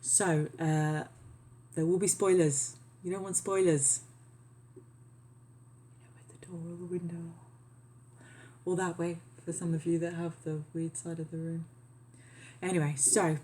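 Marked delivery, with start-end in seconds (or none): 4.8-7.3 quick unvoiced whisper. Real, not acted. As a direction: whisper it fast as an aside. Quick aside about room layout.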